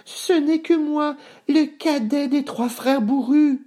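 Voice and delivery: very high, thin small-goat voice; faint-hearted, high-pitched goat; feeble little goat. Acted. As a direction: squeak timidly as a weak kid goat. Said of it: "d'une voix faible".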